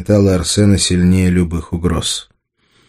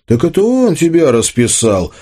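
raised pitch as it dawns on you; sudden realisation; 9 semitones higher than usual. A narrator's reading. Arsen suddenly works out who painted Anya, struck by realisation. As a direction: blurt out a sudden insight. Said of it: "Арсена вдруг осенило".

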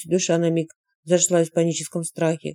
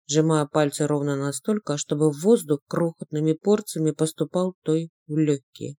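ordinary delivery

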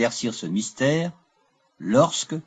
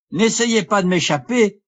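raised, much higher-pitched challenge; urgent exclamation; 8 semitones higher, 4 dB louder than usual. A narrator's reading. Lorenzo challenges the fleeing stranger, urgent high cry. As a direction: cry out to stop someone getting away. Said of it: "s'écria Lorenzo".

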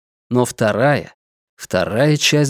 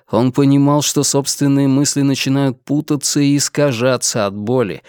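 usual speaking voice

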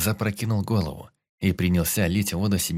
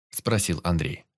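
plain reading voice

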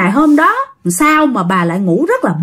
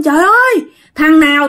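higher-pitched mock outcry; retold angry yell; 4 semitones higher than usual. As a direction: imitate a fierce uncle raising the alarm. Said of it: "chú Lâm mới la làng lên". (giả giọng chú la làng dữ dằn)

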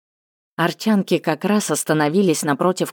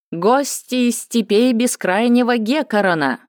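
higher, ceremonious herald call; town crier; 6 semitones higher than usual. Acted. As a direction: announce the guests grandly. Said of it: "торжественный голос глашатая".

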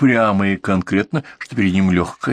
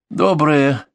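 curt greeting through a forced smile; forced reply; 6 semitones higher than usual. Acted. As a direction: hold back a grimace, answer short. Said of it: "девушка подавила гримасу".

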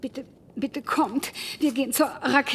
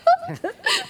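high laugh. Acted. Laughing at remembered comedy.

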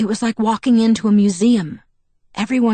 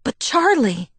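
high, alarmed plea; panicked cry; 7 semitones higher, slower than usual. A narrator's reading. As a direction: blurt it out in panic. Panicked protest to Edward.

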